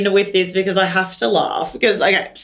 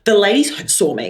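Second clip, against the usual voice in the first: ordinary talk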